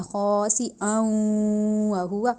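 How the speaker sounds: خاسعوں ہوا very slow with a nasal hold; slow ghunna demo; slow nasal recitation. Shown as a demonstration. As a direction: hold خاسعوں in the nose then go on. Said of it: "رک کے ناک میں لے کے جا کے".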